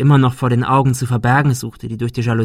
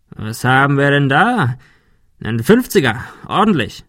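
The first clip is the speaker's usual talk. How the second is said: gloating; 3 semitones higher than usual. Acted. Thug gloats over wallet.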